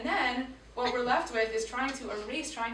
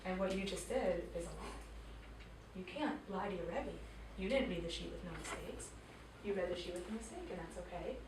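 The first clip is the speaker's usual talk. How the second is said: lowered, hushed voice of a stern mother; quiet, scary mother; 3 semitones lower, 8 dB quieter than usual. Retold or imitated. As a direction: drop low and soft, measured pauses, deadly serious. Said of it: "I went into quiet, scary mother voice".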